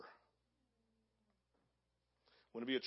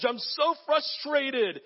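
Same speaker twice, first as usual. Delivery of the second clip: frustrated whine; 10 semitones higher, 5 dB louder, slower than usual. Pretend. Voicing a frustrated believer.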